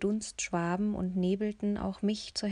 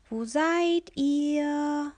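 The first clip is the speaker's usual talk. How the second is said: slow, higher, louder call; 7 semitones higher, 6 dB louder, much slower than usual. A narrator's reading. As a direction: call out searching, drawn out. Benno calling through the hallway. (langsam und laut rufend)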